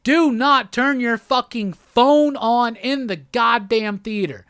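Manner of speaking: slow, emphatic, word-by-word warning; public service announcement. Real, not acted. Warning moviegoers as a public service announcement, slow and emphatic. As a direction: spell it out for everyone. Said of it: "as a public service announcement".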